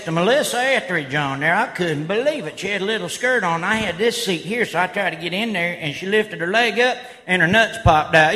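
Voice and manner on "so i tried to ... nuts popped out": usual speaking voice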